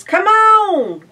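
very high, loud eager call; eager cry. Acted. Ma and Pa urging everyone to the barn, very high loud. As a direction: cry it out, raring to go. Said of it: "cried Ma and Pa".

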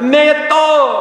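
excited God. Retold or imitated. God thrilled with mankind, exclaiming high and loud. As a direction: burst out "Me tov" in delight. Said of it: "he got so excited about us".

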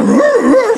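high mock growling dog. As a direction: growl like a small angry dog. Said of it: "Even the dog was like".

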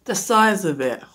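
ordinary talk

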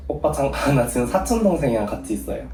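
낮춰서 조심스럽게 설명; 조용하고 얌전하게 양해 구하기; 내숭 전화 목소리. Real, not acted. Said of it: "누나 목소리 원래 그 목소리 아니잖아". (오빠 앞 나긋한 말투)

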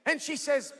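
usual speaking voice

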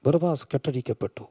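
low, soft, with long pauses; hushed preacher storytelling. Acted. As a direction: tell it gravely, almost in a whisper. Hushed dramatic storytelling in sermon.

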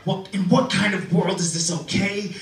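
mock the angry man yelling in his face; retold screaming. Comic imitating the furious bar patron, mock yelling.

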